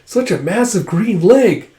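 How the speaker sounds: a friend stunned by a huge green leg, slow amazed voice; amazed gasp. Retold or imitated. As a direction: gawk at the sight in slow disbelief. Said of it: "He's like".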